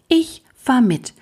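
put-on high, slow, resolute boy; determined. A narrator's reading. Jim suddenly decides to join Lukas, determined. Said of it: "sagte er plötzlich entschlossen".

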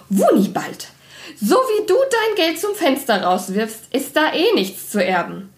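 high, indignant woman's voice on the phone; calling into phone. A narrator's reading. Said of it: "rief sie ins Telefon".

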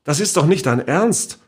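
mildly upset protest; agitated. A narrator's reading. Niece confronting the great-aunt, mildly agitated. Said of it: "sagte ich aufgeregt".

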